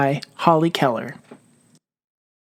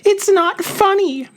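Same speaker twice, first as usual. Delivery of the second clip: high, loud upset cry from the hole; mock yell; 4 dB louder than usual. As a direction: yell it up out of the hole, hurt and cross. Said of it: "Mouse yelled".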